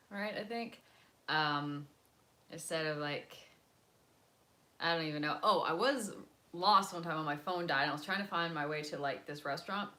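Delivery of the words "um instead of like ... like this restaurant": ordinary talk, nothing marked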